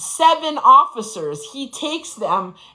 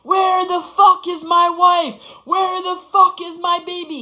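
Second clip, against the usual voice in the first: retold screaming; 7 semitones higher than usual. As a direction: roar it like a man gone wild hunting his family. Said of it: "He is in the living room screaming".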